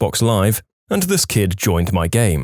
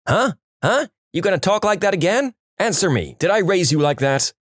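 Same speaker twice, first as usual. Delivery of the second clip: higher, fast mock-angry mother; a hint of a furious mom demanding answers; imitated mother telling off her son; scolding mom; 7 semitones higher than usual. Mom scolding son after slaps.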